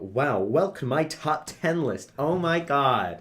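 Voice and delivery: high, loud, slow over-the-top host; hyped YouTuber voice. Shown as a demonstration. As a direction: sell it like a cheesy list video. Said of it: "Just make it sound all like".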